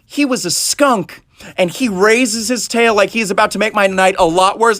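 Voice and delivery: ordinary talk, nothing marked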